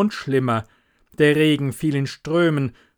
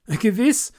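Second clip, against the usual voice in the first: much higher, louder, rising; eager exclamation; 9 semitones higher, 4 dB louder than usual. Acted. Hans agreeing eagerly to the miller, high loud call. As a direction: blurt it out willingly. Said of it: "rief der kleine Hans".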